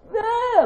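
short, high-pitched imitation wail; demonstrated wail. Shown as a demonstration. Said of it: "It's, it's a loud".

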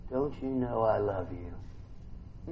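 soft, slow and low; tender reply. Acted. God gently reassuring the weeping believer, soft and slow. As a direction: hush it like a loving father.

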